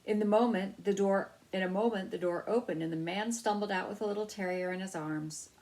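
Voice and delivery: plain reading voice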